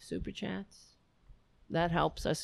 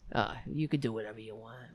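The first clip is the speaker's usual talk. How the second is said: normal talking voice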